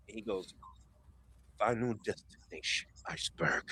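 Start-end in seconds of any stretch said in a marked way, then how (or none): 1.6-3.6 quiet attack call. Acted. Fighter calling his iceberg move, hushed and ominous. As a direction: say it under the breath, ominously. Acted attack name, far softer.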